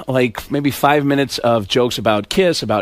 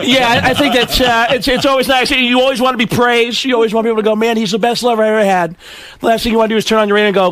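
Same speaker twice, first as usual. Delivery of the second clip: higher-pitched aggrieved comic; Sam Kinison; 6 semitones higher than usual. Acted. Comic as Kinison answering the host, aggrieved high voice. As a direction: complain in a raised voice, winding up. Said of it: "Sam, when you had sex".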